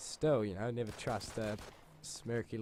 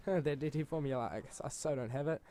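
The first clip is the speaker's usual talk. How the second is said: higher voice with a put-on chuckle; mock nervous laugh; 4 semitones higher than usual. Pupil without his form facing the teacher, mock nervous laughing.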